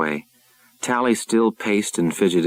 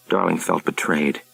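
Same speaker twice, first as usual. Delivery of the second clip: plain reading voice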